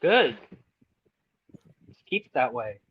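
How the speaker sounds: normal talking voice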